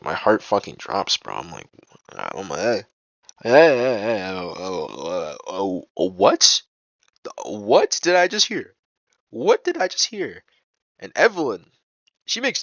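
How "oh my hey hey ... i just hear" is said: stunned disbelief. Retold or imitated. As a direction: act out being floored by what was overheard. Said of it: "I was fucking stunned hearing that".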